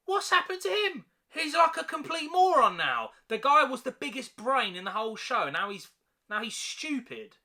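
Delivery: high incredulous ranting; escalating comedy rant. Real, not acted. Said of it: "full rant mode".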